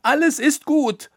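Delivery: very high, loud desperate insisting; screaming. Acted. Roppucher insists to Koníček, screaming very high and loud. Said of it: "schrie sie".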